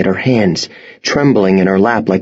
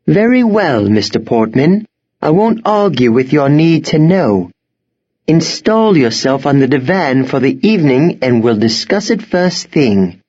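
higher, proper headmistress voice; headmistress, conceding; 6 semitones higher than usual. A narrator's reading. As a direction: give in while staying in charge.

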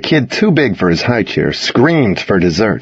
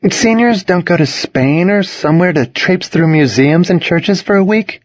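high, incredulous questioning; disbelieving; 9 semitones higher than usual. A narrator's reading. New girl asks about senior trips abroad, disbelieving. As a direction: keep the pitch up, unable to believe it.

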